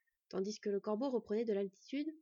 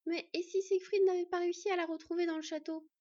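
high voice edged with panic; panicky; 8 semitones higher, 3 dB louder than usual. A narrator's reading. Fox girl fearing for her missing friend, panicky. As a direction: let worry push the pitch right up. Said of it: "avec des accents de panique dans la voix".

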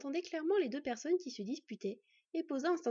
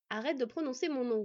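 usual speaking voice

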